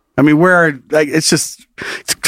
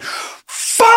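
high, drawn-out cursing shout; pent-up yell let loose at high pitch; retold outburst. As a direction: let out a long comic scream of frustration. Retold outburst outside after call.

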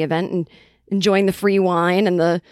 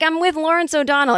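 put-on Boston accent; 9 semitones higher than usual. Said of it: "talking in our Boston accents".

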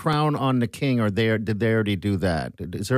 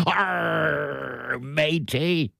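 pirate voice; 2 semitones higher, much slower than usual. Pretend. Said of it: "It sounds terrible".

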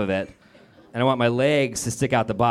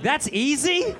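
much higher incredulous squeak; mock disbelief; 12 semitones higher, slower than usual. Comic to the instructor, high incredulous mock squeak. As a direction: act stunned at the easy drill.

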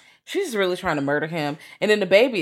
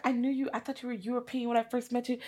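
mock flirty quote; 7 semitones higher, 9 dB quieter than usual. Retelling wife's flirty line.

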